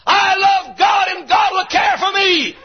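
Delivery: high, ringing cry of faith; defiant shout. Acted. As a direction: shout it across at the devil. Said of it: "look back at the devil and the world and shout".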